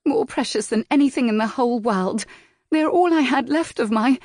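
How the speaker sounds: lightly voiced young woman near tears; grieving young lady. A narrator's reading. Miss March voiced, grieving.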